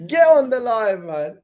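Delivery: loud, high, drawn-out call; amused urging. Real, not acted. Streamer urges mum onto the live, loud drawn-out call. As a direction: slowly holler someone over, high and playful.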